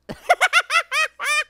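high, loud burst of laughter; loud laughing fit. Real, not acted. Player laughing at own catchphrase, loud laughing fit. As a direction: crack up at your own joke.

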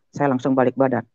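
usual speaking voice

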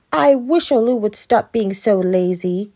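mildly heated exclamation about a brother; exclaiming. A narrator's reading. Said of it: "she exclaimed".

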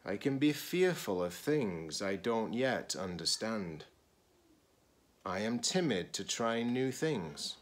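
expressive picture-book read-aloud; reading to children. Acted. Teacher reading a picture book to students, expressive read-aloud. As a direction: read it slowly to a class of children.

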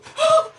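sharp, very high, loud gasp of fright. Real, not acted. Player jump-scared by a man, loud frightened gasp. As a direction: suck in air in real shock.

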